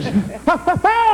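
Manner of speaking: giggling burst; high burst of laughter. Real, not acted. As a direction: crack up high. Laughing at own joke.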